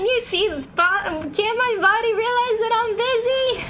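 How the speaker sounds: whiny. Real, not acted. Whining at own body.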